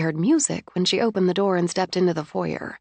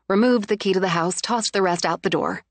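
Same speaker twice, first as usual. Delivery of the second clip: plain reading voice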